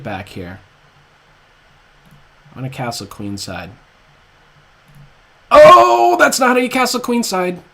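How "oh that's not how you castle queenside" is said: high loud startled cry then laugh; shocked shriek. Real, not acted. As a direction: yelp at your own blunder. Botched castling, laughs.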